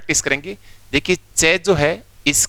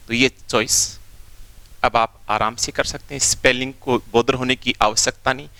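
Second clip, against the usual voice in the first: ordinary talk, nothing marked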